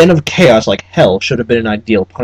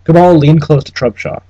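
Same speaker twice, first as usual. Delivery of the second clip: ordinary delivery, nothing marked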